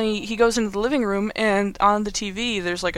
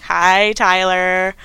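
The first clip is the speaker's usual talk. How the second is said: mock sweet greeting; 7 dB louder, much slower than usual. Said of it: "she's like".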